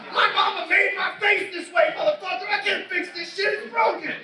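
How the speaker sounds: high, loud, slowed comic outburst; hammed-up indignant yelling, pitch way up; mock angry shout. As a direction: yell it like you're fed up with being called mad. Mock reply to being called intense.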